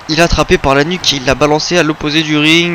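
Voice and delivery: normal talking voice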